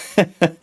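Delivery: short burst of relieved laughter. Real, not acted. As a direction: break off into a laugh. Relieved after surviving the job.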